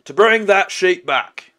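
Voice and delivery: self-important orc on his master's errand; insistent orc henchman. Acted. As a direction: lay down the demand forcefully. Plays the orc.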